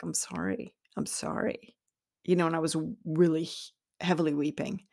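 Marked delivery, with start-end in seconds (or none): 0.0-1.8 tearful apology. Retold or imitated. Weeping while leaving.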